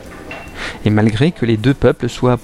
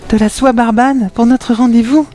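much higher, very slow gushing voice; melting; 13 semitones higher, 4 dB louder, slower than usual. A narrator's reading. Adenor gushes over the silk shirt, high slow cooing. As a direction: coo it like you're melting. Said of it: "fondit Adenor".

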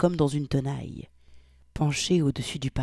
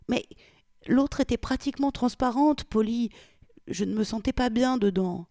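higher female voice; 8 semitones higher than usual. Acted. Victoria defends herself.

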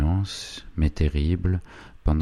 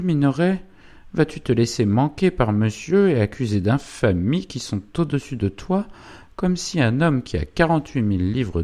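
higher-pitched indignant wife, swinging tune; Zélie; 9 semitones higher than usual. Acted. Wife denies all, then goads silent husband, indignant. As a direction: play a scornful wife goading her husband.

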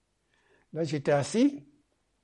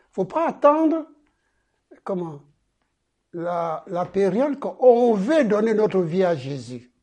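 loud, raised-pitch exhortation; emphatic preaching; 5 semitones higher, 8 dB louder than usual. Real, not acted. Preacher urging the congregation not to wait, emphatic preaching. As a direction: preach it with drawn-out, forceful phrases.

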